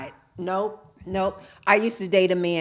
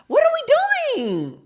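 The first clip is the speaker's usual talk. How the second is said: high, loud, drawn-out wail; hammed-up exasperated squeal; exasperated outburst; 6 dB louder, slower than usual. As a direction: shriek it slowly in mock despair.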